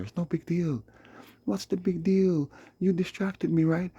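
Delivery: high, loud put-on voice mimicking her; mocking girl's excuse.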